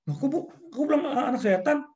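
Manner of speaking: high, indignant protest; a kid questioning the parent; high childish talk-back. Retold or imitated.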